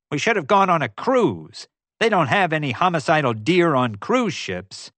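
grouse on and on about the deer; ranting. A narrator's reading. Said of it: "kept on ranting".

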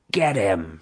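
plain reading voice

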